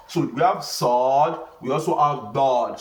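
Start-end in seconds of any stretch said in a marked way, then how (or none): none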